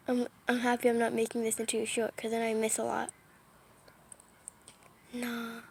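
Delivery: usual speaking voice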